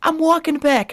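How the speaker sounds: high, loud shrieking mock of mom; mocking mom's outburst. Son imitating his mother's car-breakdown tantrum, high mock shriek. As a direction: squeal it like a frantic mother losing it. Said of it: "She's like".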